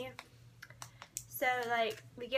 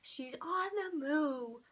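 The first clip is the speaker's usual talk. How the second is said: drawn-out "on the move", half speed; freaking out; 3 semitones higher, much slower than usual. Retold or imitated. Fan watching Mama Swift leave, slow drawn-out freak-out. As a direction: stretch each word in mounting disbelief. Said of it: "I was freaking out".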